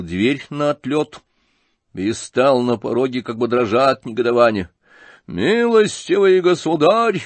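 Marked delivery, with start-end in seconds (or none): 5.3-7.2 high, loud, drawn-out outburst; thunderous shout. A narrator's reading. General confronting Птицын in a thunderous shout. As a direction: thunder it out slowly, pitch raised. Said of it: "закричал он громовым голосом".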